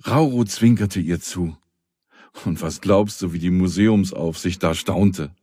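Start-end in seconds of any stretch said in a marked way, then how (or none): none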